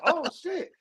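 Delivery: mock alarm. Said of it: "I'm like".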